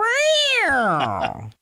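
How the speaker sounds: high cat yowl, sliding down; long drawn-out mock meow; imitated cat noise. Host fills in for absent cat on hearing viewer's name, imitated meow. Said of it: "York's not here, so I'll just go".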